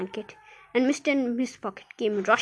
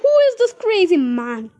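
high, loud demand; outraged scream; 7 semitones higher, 8 dB louder than usual. A narrator's reading. Grandma demands who crashed in, screaming. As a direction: yell the question in outrage. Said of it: "screamed Grandpa Josephine".

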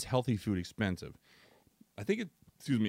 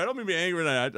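ordinary talk, nothing marked

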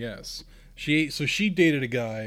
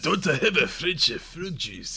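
mock French accent. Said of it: "You said".

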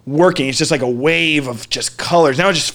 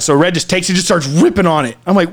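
ordinary delivery

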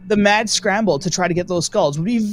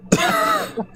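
high squealing laugh; sudden delighted laugh; burst of laughter. Real, not acted. Reacts to cohost's odd face.

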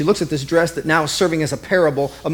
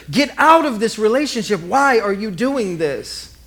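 raised, higher pleading; pleading friends; 7 semitones higher than usual. Retold or imitated. Friends begging her to leave her marriage, pleading. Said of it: "They tried to convince her".

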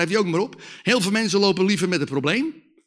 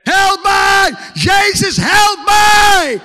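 high, very loud drawn-out cry for help; desperate scream; 11 semitones higher, 8 dB louder, much slower than usual. Retold or imitated. Addicted man cries out to Jesus for rescue, desperate scream. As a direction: scream it out, holding each last syllable. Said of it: "Ja, zo ging het, hoor".

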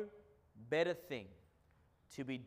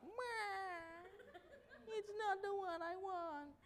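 put-on much higher, whiny spoilt-kid voice; sulky child; 8 dB quieter than usual. Pastor imitating an ungrateful child, high sulky whine. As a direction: whine like a kid with the wrong present.

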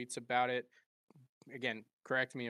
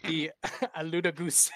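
higher, louder, giggling through the name; laughing mangled name; 7 semitones higher, 8 dB louder than usual. Real, not acted. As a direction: break up laughing mid-word. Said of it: "Is it even a real event at this point".

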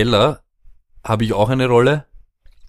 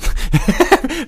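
high laugh burst. Real, not acted.

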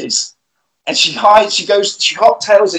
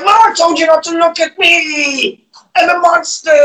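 monster voice; 10 semitones higher than usual. Retold or imitated.

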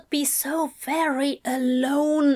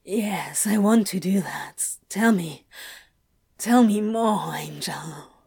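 hushed, dazed; 3 semitones lower than usual. A narrator's reading. Crowley begging Aziraphale to go on, dazed whisper. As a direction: breathe the words out, lightheaded. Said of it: "Crowley whispered dizzily".

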